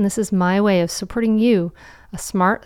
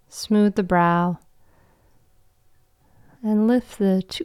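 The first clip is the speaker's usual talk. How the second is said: guided meditation. Acted. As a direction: guide them into calm, with long pauses.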